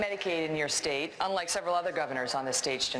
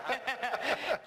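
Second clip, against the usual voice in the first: short laugh; 5 semitones higher than usual. Real, not acted. Host laughs after the debate.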